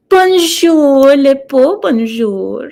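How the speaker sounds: loud, high, theatrical "Bonjour"; showy French greeting. Acted. Greeting a chatter in French with a loud, high showy call. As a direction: belt it out like a grand hostess.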